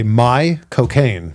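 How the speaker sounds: slow Cockney-style accent on My cocaine; Michael Caine. Shown as a demonstration. Showing how to fake the actor's London accent, slowly. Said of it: "the way to do a Michael Caine accent".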